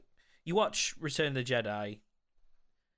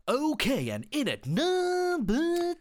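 high, slow, cheesy countdown DJ; Tony Blackburn voice; 13 semitones higher, 4 dB louder, much slower than usual. Retold or imitated. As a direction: stretch it out like an old chart-show host. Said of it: "when you hear him, he's just like".

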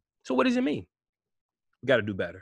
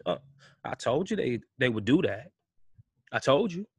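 ordinary talk

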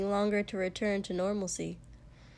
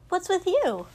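high, loud teasing friend; Mina's teasing prod; 10 semitones higher, 6 dB louder than usual. A narrator's reading. Friend nudges a drowsy boy at breakfast, high and teasing. Mina prods Shoto at breakfast.

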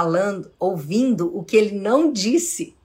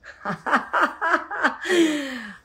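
laugh; 8 semitones higher than usual. Real, not acted. Joking about her age.

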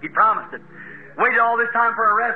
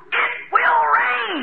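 slow echoed promise; 12 semitones higher, much slower than usual. Acted. Daughter-in-law echoes promise.

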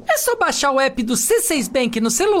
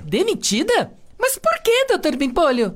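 shocked disbelief. Acted. Secretary shocked at being fired.

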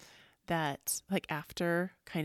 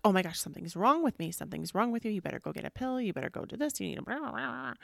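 higher, hurried mock alarm; mock panicking people; 5 semitones higher, 4 dB louder, faster than usual. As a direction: imitate people fretting, rising into babbled blahs. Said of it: "when people start feeling things, it's when they're like".

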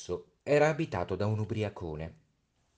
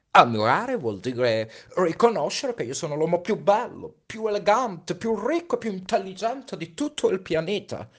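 high, preening list of virtues; boastful; 12 semitones higher, 8 dB louder than usual. Acted. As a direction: puff yourself up with each word. Vain man boasting.

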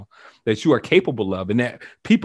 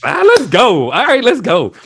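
much higher, loud rallying cry; hype shout; 10 semitones higher, 8 dB louder than usual. Real, not acted. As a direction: pump them up, let's go. Hypes up partner, high and loud.

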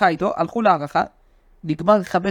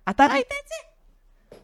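high, slow disbelieving squeal; mock amazement at a ridiculous report; incredulous; much slower than usual.

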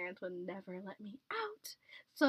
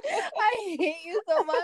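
laughing; 11 semitones higher, 12 dB louder than usual. Real, not acted. Laughing mock protest.

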